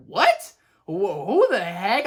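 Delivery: mock bafflement. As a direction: react in hammed-up disbelief. Acts out baffled viewer's reaction.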